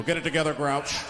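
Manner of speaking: low, dismissive sneer at the grouch. Retold or imitated. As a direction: brush him off like a snob. Neighbours scold Oscar.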